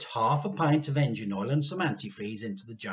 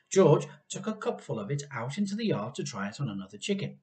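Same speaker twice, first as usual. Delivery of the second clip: plain reading voice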